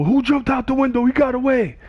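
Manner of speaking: high, urgent call; hammed-up cop yelling that a suspect escaped; excited cop shout. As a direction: raise the alarm. Cops storming in, shouting.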